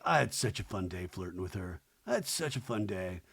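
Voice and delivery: low, soft imitation of him; mock boyfriend confiding, voice dropped; quiet, low guy voice; boyfriend's part. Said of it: "But I like, like".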